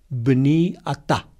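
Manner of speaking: "bni atá" said slowly in Hebrew, bni as beni, stress on bni; Hebrew words. Shown as a demonstration. Preacher showing listeners the Hebrew, slow careful "bni atá". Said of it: "así se dice en hebreo".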